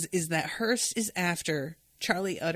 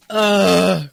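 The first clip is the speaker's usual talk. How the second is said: emotional groan; 13 dB louder than usual. Real, not acted. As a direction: let out a big teary groan. Overwhelmed, needs tissues.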